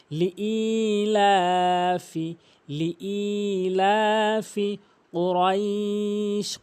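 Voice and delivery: লিইলাফি with লিই and লা each drawn out; slow chanted লিইলাফি; long lii. Shown as a demonstration. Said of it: "এক আলিফ টেনে উচ্চারণ".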